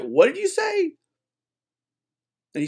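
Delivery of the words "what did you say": much higher offended question; indignant shock. Retold or imitated. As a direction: ask it as if scandalized. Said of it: "they're like".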